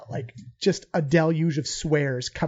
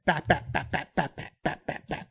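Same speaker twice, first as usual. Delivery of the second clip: fading bap noises; 2 semitones lower, 6 dB quieter than usual. Acted. Fish punching goblin sound.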